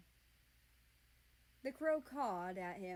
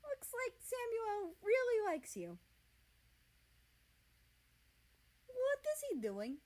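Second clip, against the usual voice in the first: much higher, louder startled voice; pitched way up in surprise; high flustered voice; 14 semitones higher, 5 dB louder than usual. A narrator's reading. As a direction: squeak it out, taken aback. High voices with crow on hand.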